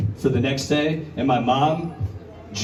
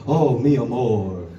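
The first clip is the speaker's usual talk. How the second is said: romantic cat; 4 semitones lower, slower than usual. Pretend. Cats in a meadow romance.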